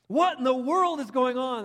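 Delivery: high, loud frightened cry; a hammed-up scared shout; terrified shepherds. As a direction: blurt it out in panic. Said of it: "That had to be their thought".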